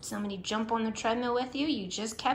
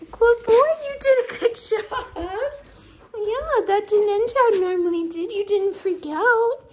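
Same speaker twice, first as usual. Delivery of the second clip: high, loud, gushing praise for a good dog; excited pet praise; 12 semitones higher, 7 dB louder than usual. Real, not acted. Owner praising her dog on the treadmill, high and excited. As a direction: cheer the dog on in a sky-high sing-song voice.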